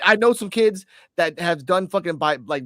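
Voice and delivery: ordinary talk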